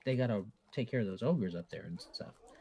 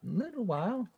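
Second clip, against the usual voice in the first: NPC voice; 6 semitones higher than usual. Acted.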